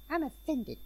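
high, prim little "I'm offended"; squeaky, indignant mock protest. Mom offended by taser joke.

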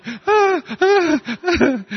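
repeated high squealing screams; mock panic screams at the spider; girlish screams. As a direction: squeal again and again as you jump up. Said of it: "And I jumped up like this".

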